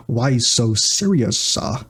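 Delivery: slow, drawn-out villain impression; Heath Ledger. Demonstrating Joker doing another Joker, slow impression. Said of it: "He does the Heath Ledger".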